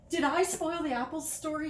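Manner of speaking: high, loud, rushed; worried rushed blurt. Acted. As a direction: blurt it out anxiously. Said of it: "he blurted out".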